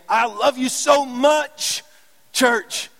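high, loud, drawn-out declaration of love; passionate plea. Acted. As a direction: cry out with a preacher's full passion. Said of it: "it's with that kind of passion".

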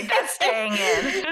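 slow teasing words through laughter; laughing. Real, not acted. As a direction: tease while laughing.

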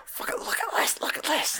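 hushed, voiceless aside; conspiratorial mock whisper; imitated excited whisper. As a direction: whisper it like nudging a mate to look. Retold guards at security camera.

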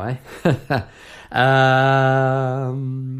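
normal talking voice

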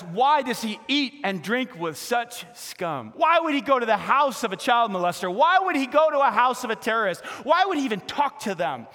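loud, high scornful outrage; bitter complaining critics. Retold or imitated. Pharisees complain to the disciples, loud scornful outrage. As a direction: sneer it like offended Pharisees. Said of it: "They muttered, they complained bitterly".

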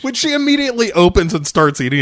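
high, loud, fast narration; giddy quick aside; excited rush. Real, not acted.

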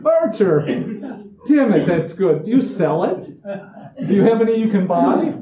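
loud, high hammed-up gushing; mock excited praise. Fans gushing to the singer about his song, mock excitement. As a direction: play an overeager fan.

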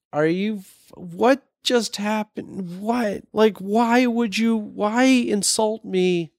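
high, drawn-out, halting bewilderment; stunned disbelief. Retold or imitated. Writer reacting to a stranger's insult, in stunned disbelief. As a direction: act out being baffled, stretching the words.

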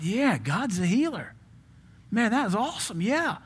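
high, thrilled realization; light up at the news; excited inner thought. Retold or imitated. Young student thrilled by the healing message, excited. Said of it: "And I thought".

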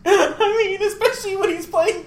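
high-pitched words through a laugh; cracking up mid-sentence. Real, not acted. Laughing about John Wayne racism.

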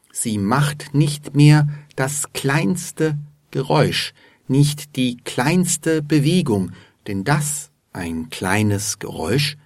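usual speaking voice

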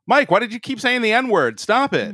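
high-pitched pretend telling-off; playful, high-pitched reproach; mock scolding. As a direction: scold a friend in fake exasperation. Joking mock scolding of guest.

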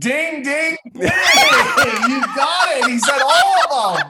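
high, laughing game-show cheer; host cheering a right answer, laughing high; excited laughing cheer. Real, not acted. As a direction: shout it out in delight, laughing.

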